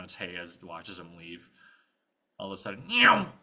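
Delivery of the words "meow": loud vocal zoom effect; zooming whoosh. Acted. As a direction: make a swooping cartoon zoom. Imitated whoosh of sudden movement.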